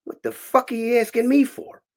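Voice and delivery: high-pitched, indignant retort; acted-out indignant challenge; indignant comeback. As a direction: throw the question back, high and offended. Snapping back at a stranger's question.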